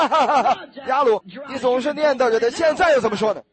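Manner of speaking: mocking crowd laughing; laughing mockery. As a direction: laugh him down. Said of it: "他们说".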